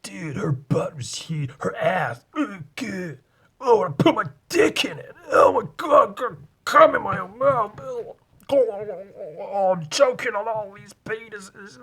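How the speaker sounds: hammed-up American bro; mock American dude. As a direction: get carried away like a crude frat boy. Escalating mock American dude rant.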